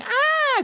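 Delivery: sudden high-pitched yelp. Retold or imitated. Crowd reacting to a stripper with a high yelp. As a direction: yelp in a high burst of surprise.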